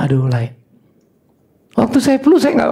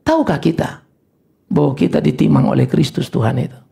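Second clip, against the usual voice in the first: normal talking voice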